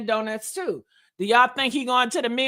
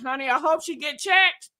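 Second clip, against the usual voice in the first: ordinary talk, nothing marked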